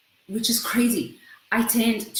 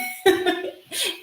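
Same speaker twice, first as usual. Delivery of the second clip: high giggling burst; cheeky high giggle; embarrassed laugh; 11 semitones higher than usual. Real, not acted. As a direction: laugh off the confession. After confessing no pants.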